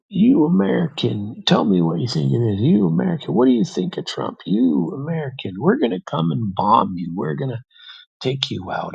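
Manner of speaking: lightly voiced drunk man badgering a foreigner; drunk ranting Russian. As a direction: play the tipsy stranger on a tear.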